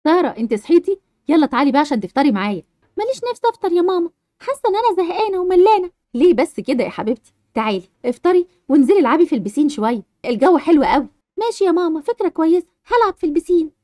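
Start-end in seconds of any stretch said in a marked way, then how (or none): 0.0-2.6 lower, gentle mum calling her daughter; a lower motherly call to breakfast; mother's voice. Acted. Mother waking Sara for breakfast, lower gentle voice. 6.1-11.1 lower, gentle mum calling her daughter; a lower motherly call to breakfast; mother's voice. Acted. Mother waking Sara for breakfast, lower gentle voice.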